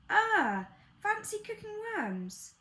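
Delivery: put-on high child, loud opening cry; very high, scandalised child's voice; child's exclamation. Child reacts to worm dish.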